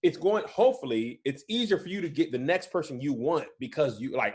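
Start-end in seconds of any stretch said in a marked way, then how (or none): none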